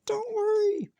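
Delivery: a tiny mouse calming a big lion; peeping mouse. Acted. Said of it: "Mouse peeped".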